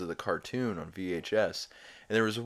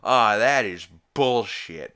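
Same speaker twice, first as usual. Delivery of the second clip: loud, drawn-out protest; mock outburst; 3 semitones higher, 11 dB louder, slower than usual. Peter about to stand up in court, loud mock protest. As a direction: stretch it into an indignant outburst. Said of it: "sounds like he's about to stand up and go".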